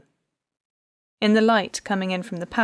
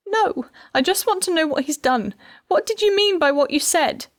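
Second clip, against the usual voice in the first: distraught; 9 semitones higher, 5 dB louder than usual. A narrator's reading. Distraught wife demands answers.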